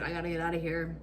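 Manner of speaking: low, hushed urgency; hushed panic. Retold or imitated. Teller hiding from police in the store, hushed and urgent. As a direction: keep it under your breath.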